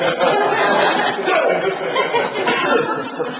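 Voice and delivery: high breathy burst of laughter. Real, not acted. Preacher cracking up, high laugh. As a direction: let a loud laugh fly up.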